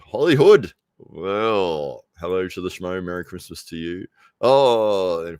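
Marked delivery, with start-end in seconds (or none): 0.1-0.7 loud greeting. Real, not acted. Greeting a viewer, repeated louder.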